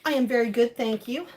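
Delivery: normal talking voice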